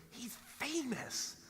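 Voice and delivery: high, drawn-out insistence; awed announcement. Retold or imitated. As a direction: stretch it out high to impress a kid. Dad tells daughter about guest.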